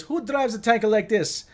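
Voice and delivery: loud, high, hammed-up Italian accent; Italian mechanic. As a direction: call out and protest as a mock Italian mechanic. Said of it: "typical Italian mechanic".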